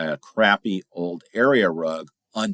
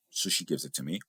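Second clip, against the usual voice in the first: ordinary talk, nothing marked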